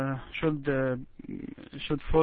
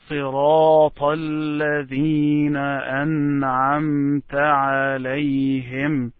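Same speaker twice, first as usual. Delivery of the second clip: Quranic recitation; 4 dB louder than usual. Shown as a demonstration. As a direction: model the phrase with long held vowels. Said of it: "So you can stop after saying".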